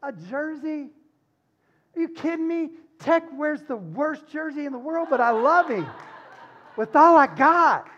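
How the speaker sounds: can you believe it, a jersey; incredulous preaching. Real, not acted. Preacher laughing about loving a fan of another team.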